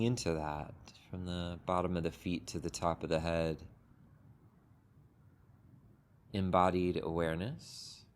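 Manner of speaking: unhurried, gentle meditation guidance with long pauses; guided meditation. Acted. Teacher guiding the group into a sitting meditation, calm and unhurried. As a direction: ease the group into stillness, one phrase at a time.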